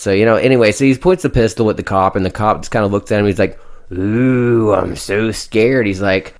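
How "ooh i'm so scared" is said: sarcastic mock fear. Cop mocks gunman sarcastically.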